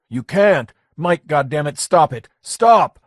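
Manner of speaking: Lenny shouting at Mike to stop. A narrator's reading. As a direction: yell at him to stop.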